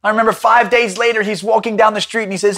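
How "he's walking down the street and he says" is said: normal talking voice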